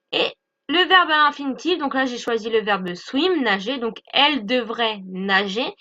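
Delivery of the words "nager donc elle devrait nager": normal talking voice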